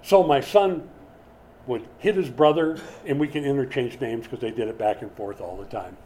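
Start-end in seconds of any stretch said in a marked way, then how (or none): none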